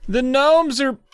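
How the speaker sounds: high, drawn-out mock wail; tattling kid. Joe running inside to tell Mom, high mock whine. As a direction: call out like a kid telling on someone.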